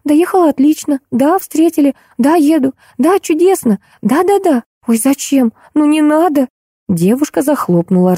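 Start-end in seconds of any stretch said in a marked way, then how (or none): 0.0-6.5 put-on high girlish phone chatter; chirping. A narrator's reading. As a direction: chirp short answers in a high little voice. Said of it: "зачирикала гостья". (щебет по телефону тонким голоском)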